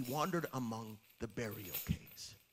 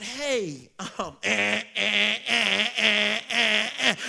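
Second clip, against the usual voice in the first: flustered stammer; 5 semitones higher, 8 dB louder, much slower than usual. Retold or imitated. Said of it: "I said".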